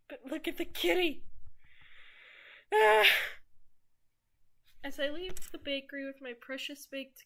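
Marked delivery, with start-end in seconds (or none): 0.1-3.1 high, loud gushing over a kitty; excited squeal. Real, not acted. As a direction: squeal it out with delight. Squealing at a cute cat.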